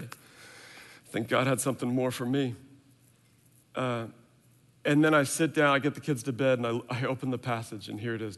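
normal talking voice

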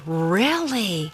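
a soft, drawn-in "Really?"; mock interest. Shown as a demonstration. Teacher's demo of listening to a sixth grader, soft mock surprise. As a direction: show you're hooked, softly. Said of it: "supposed to respond with".

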